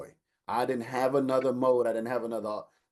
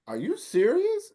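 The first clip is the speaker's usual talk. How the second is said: high, slow incredulous question; can't believe she's saying this; disbelieving; 4 dB louder than usual. Retold or imitated. Son to scolding mother, high incredulous question.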